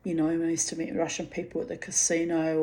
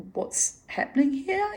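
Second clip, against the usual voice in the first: put-on high, baffled young girl; mimicking her bewildered teenage self to the sailors, high and puzzled; confused teen; 13 semitones higher than usual. Russian sailors approach, she squeaks in confusion. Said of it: "I'd be like".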